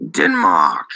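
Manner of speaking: low, nervously grinned bluff. A narrator's reading. Daniel lies to the shopkeeper about home, grinning nervously. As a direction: bluff it through a nervous grin. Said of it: "Daniel grinned nervously".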